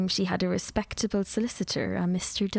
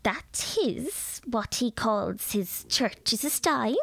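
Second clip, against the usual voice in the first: a grand lady holding forth, pitched a little higher; lecturing lady; 4 semitones higher than usual. A narrator's reading. Mrs Dawson lectures the nervous girl about new tea, grandly. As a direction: pronounce on tea like an authority.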